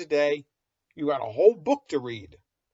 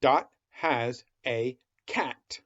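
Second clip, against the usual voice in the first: slow reading aloud; slower than usual. Shown as a demonstration. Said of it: "go nice and slow".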